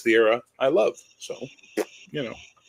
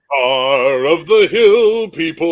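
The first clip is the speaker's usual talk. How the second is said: slow, loud, booming announcer proclaiming a title; mock grand title; 5 semitones higher, 11 dB louder, slower than usual. Cohost bestows a joke epic title, mock heraldic boom. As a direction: hail him like a hammed-up herald.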